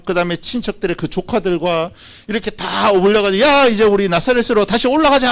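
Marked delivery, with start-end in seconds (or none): 3.4-5.3 higher-pitched rallying call. Acted. Parent to relatives heading home to Nazareth, calling out. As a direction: call the group to set off. Family acted setting off home.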